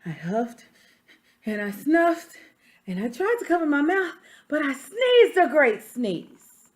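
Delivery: reading a picture book aloud as the wolf; story narration. Acted. Teacher reading to children, storytelling voice. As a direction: tell it to the class like the wolf's own tale.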